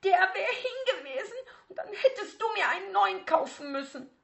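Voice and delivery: put-on a teenage girl losing her temper; getting shriller. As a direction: immer schriller. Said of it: "dass ihre Stimme immer schriller wurde".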